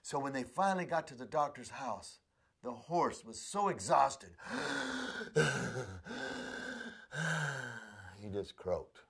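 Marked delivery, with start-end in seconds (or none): 4.4-8.2 long dying sigh. Acted. Horse dies exhausted.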